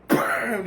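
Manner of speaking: loud, high "bam" sound effect; imitated hammer slam.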